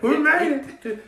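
mock triumphant cheer. Friends sneaking into club, cheering at the door, drawn out. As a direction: celebrate getting in, slowly. Cheering after getting past the line.

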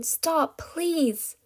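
high, desperate begging; pained pleading. A narrator's reading. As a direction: plead through the pain. Scream continues in pain.

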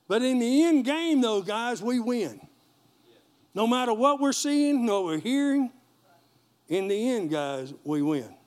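loud, high triumphant preaching; rally the congregation to victory; full-force preaching. Acted.

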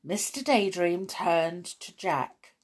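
usual speaking voice

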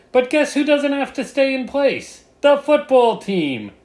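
high, loud sarcastic punchline; sarcastic reveal. Acted. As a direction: land the punchline with mock outrage.

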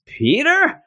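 very high questioning voice; Mary Jane. Retold or imitated. Mary Jane coming through the door, high surprised question. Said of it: "Mary Jane comes through the door and says".

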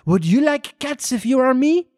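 put-on high, indignant French accent; shrill French mouse. A narrator's reading. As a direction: protest as an offended French mouse. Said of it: "in a shrill, passionate voice".

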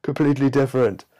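high, loud indignant nitpicker; mock pedantic biologist. Mock biologist snapping at the teller over toad versus frog. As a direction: huff like an offended biologist correcting you. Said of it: "you're like".

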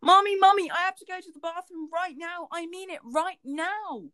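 put-on high, loud little boy begging for the bathroom; Connor pleading. Child begging his mother for the bathroom, high pleading voice. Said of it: "he goes".

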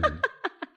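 a quick rising laugh; short laugh. Acted. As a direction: laugh lightly in reply. Laughs at the king's reply.

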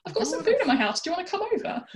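a hint of a too-friendly stranger; mock friendly stranger. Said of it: "someone came up to you and said".

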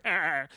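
imitated yell of a character's excitement; excited cry. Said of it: "the excitement of, uh, of Perry".

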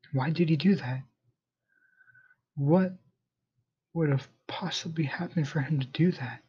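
soft, held-in upset question; keeping composure. A narrator's reading. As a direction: ask it quietly, struggling to stay composed. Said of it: "while trying to keep my composure".

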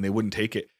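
ordinary talk

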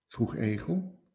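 ordinary delivery

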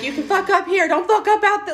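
hammed-up high coaching voice; fiery coach quote. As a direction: pitch it up like a fired-up coach. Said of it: "And it's like".

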